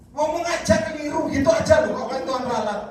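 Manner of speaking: lebih tinggi dan keras, menyindir; tegur mengejek. Retold or imitated. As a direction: sindir dengan nada tinggi. Pendeta menegur jemaat di telepon. (teguran sinis pada penelepon)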